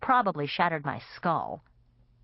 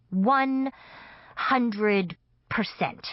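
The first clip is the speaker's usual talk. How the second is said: slow, high stressed One hundred percent; emphatic; 5 semitones higher, much slower than usual. Acted. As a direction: stretch out One hundred percent, each word hit hard. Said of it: "I said emphatically".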